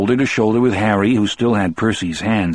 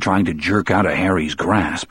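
ordinary delivery, nothing marked

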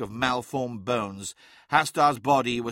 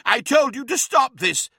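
loud high cry of anger; 12 semitones higher, 7 dB louder than usual. Acted. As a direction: shout it at him. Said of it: "cried Araman".

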